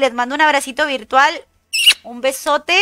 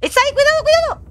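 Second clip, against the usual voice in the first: high, urgent cry of alarm; alarmed warning; 13 semitones higher, much faster than usual. Real, not acted. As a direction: yell a warning as the enemy appears. Enemy spotted, warning teammates.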